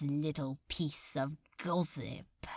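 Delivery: whispered gossip; conspiratorial whisper. A narrator's reading. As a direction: lean in and whisper it. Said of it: "casually continuing their conversation".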